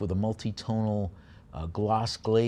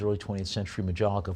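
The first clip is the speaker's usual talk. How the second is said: normal talking voice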